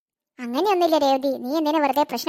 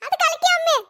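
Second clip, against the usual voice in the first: put-on much higher child voice; taunting little sister; 12 semitones higher than usual. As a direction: needle her sister like a smug small girl. Sister taunts during beating.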